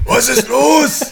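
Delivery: high loud called reply; shouting back. Pretend. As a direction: call back from far away. Answers the shout.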